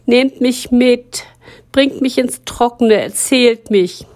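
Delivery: a wet story begging to be taken in; whining plea. Acted. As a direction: drag out the begging. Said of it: "jammerte die Geschichte".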